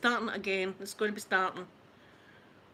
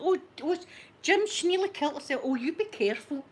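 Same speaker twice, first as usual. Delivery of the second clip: higher-pitched imitation of a worried mum; mock alarm, pitched up as if calling her husband; fussing mum; 6 semitones higher than usual. Mum telling Jim about the fall, mock fussing.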